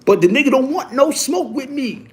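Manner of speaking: ordinary talk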